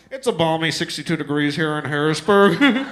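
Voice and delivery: breezy captain intercom voice; pilot voice. Retold or imitated. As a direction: announce it like a chipper pilot. Pilot's cheerful intercom line.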